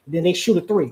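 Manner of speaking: usual speaking voice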